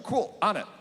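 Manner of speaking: high, eager student voice. Retold or imitated. Student agreeing to run home, eager and high. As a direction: chirp it like a keen kid. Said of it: "She's like".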